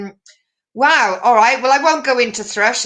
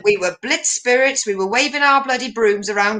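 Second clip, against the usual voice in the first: normal talking voice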